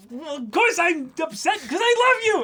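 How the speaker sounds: high, loud emotional confession; girlish confession. Retold or imitated. A girl confessing her love to her friend, high and loud. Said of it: "be like".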